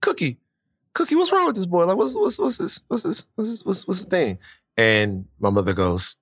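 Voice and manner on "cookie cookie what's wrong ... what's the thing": questioning dad. Retold or imitated. Dad asks Cookie about their boy, high and puzzled. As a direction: repeat the question like a baffled dad.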